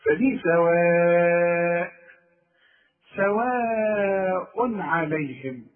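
سواء عليهم with a very long madd and clear tanween, no ghunna; long madd, no nasal. Shown as a demonstration. Teacher reciting سواء عليهم very slowly. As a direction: stretch سواء, then say عليهم cleanly. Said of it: "أنت لاحظت إن أنا ضمتين وما غنيتش".

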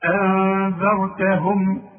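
أنذرتهم drawn out slowly, nun hidden nasally before the dhal; إخفاء بغنة; slow tajweed recitation. Shown as a demonstration. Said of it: "شوف".

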